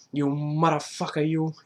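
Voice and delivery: amused wiseguy impression; "muttafucka" in a playful mobster voice; mock-tough gangster, on the edge of laughter; Joe Pesci. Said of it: "Joe Pesci made the word motherfucker funny".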